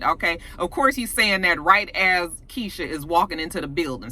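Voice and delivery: ordinary talk, nothing marked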